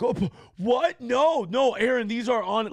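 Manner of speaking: hammed-up flustered denial; flustered protest. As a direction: play the startled guy backpedaling fast. Caught-out guy protesting.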